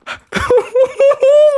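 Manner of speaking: very high loud hysterical shriek of a laugh; panicked laugh-scream. Real, not acted. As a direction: let the panic burst into a shrill laugh. Panic while fleeing enemies.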